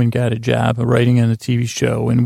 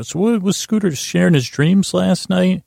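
higher-pitched, lilting mock questioner; put-on higher voice asking over breakfast; imagined breakfast questioner; 7 semitones higher than usual. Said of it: "the conversation at breakfast tomorrow".

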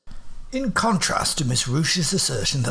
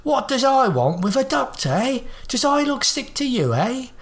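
put-on much higher, cracked old man voice; indignant old man; 12 semitones higher than usual. Old man scorning the need for a doctor, indignant. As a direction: bristle like a touchy old geezer.

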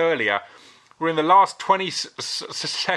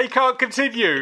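laughing ref quote; 8 semitones higher than usual. Retold or imitated. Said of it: "The ref was like".

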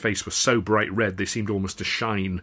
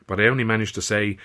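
usual speaking voice

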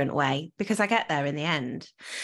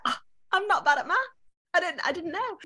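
high, quick mock amazement; squeaky fake revelation to the husband; mock surprise; 11 semitones higher, faster than usual. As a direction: act stunned at your own discovery. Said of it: "going through to my husband and be like".

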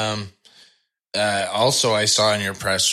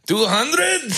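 mock shocked. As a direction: play the clerk's disbelief, laughing. Clerk's shocked reply retold.

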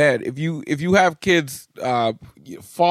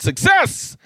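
excited cheer. Real, not acted. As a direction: celebrate like you finally made it. Celebrating the podcast coming out on time.

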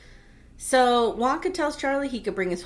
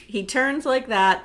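ordinary talk, nothing marked

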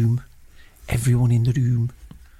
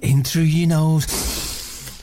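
slow calm breathing guide; guided meditation; 5 semitones higher, slower than usual. Acted. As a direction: draw each breath out slowly. Breathing guidance.

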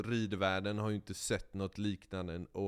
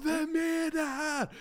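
amazed crowd; 5 dB louder than usual. Retold or imitated.

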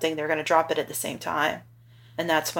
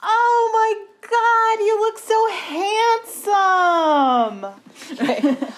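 overjoyed; 5 dB louder than usual. Real, not acted. Mom sees son dressed for prom.

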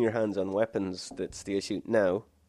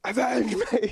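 high, loud dying cry; overacted death scene; 14 semitones higher, 5 dB louder, slower than usual. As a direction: scream it like a hammy dying hero. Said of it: "he just starts randomly going".

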